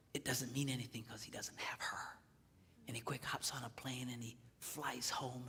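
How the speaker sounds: soft, hushed retelling; hushed, intimate storytelling voice. A narrator's reading. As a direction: draw them in with a near whisper.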